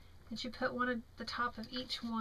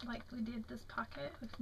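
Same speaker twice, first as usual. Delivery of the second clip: whisper; 7 dB quieter than usual. Real, not acted. Aside while showing pockets.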